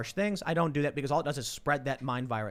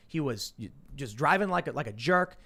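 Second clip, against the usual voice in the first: ordinary talk, nothing marked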